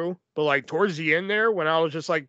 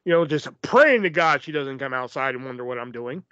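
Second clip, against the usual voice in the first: ordinary talk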